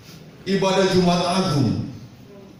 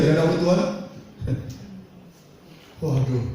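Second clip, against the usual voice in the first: ordinary delivery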